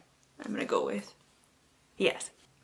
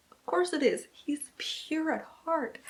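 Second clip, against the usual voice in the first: swooning whisper; 5 semitones higher, 7 dB quieter than usual. Real, not acted. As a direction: sigh it out like you're melting. Swooning aside about hero.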